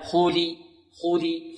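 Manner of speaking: short demonstration of "li" in "خولي", not "le"; pure kasra. Shown as a demonstration. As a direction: say "خولي" ending in a clean i. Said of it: "فرق بين خولي وخولي". (نطق "خولي" بكسرة خالصة)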